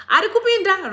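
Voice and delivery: high, loud hype call; excited cheer. Real, not acted. Hyping a viewer who joins the live, high and loud. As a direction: cheer him into the room.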